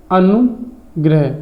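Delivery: अनुग्रह said slowly, syllable by syllable; slow careful word. Shown as a demonstration. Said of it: "ये तो same एक ही जैसा लिखा हुआ है". (अनुग्रह धीरे-धीरे)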